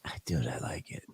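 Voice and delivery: whispered confession. Real, not acted. Admitting to his friend he likes it, whispering. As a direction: breathe it out with no voice.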